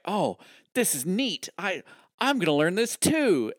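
high-pitched mock wonder; hammed-up childlike enthusiasm; eager kid. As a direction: play an excited son discovering a new toy. Voicing Crota's eager discovery.

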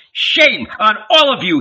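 high, loud rebuke; indignant high shout; crying out. A narrator's reading. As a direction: cry out in outrage. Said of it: "he cried".

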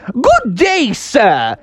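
high, polite rabbit gentleman; bunny gentleman voice. Acted. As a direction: greet like a dapper old bunny. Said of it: "and he said".

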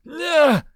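high, loud screech; short high-pitched cry; sudden yell. A narrator's reading. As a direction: let out a loud startling shriek. Goblin yell wakes the pilot.

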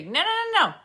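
high, loud insistent no; mock emphatic no. Quoting Di's emphatic correction, loud insistent no.

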